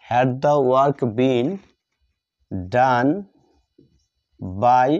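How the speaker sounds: very slow, word by word, as if writing it out; slow dictation. Shown as a demonstration. Teacher writing on the board, dictating slowly to the class. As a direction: draw each word out for the class to copy.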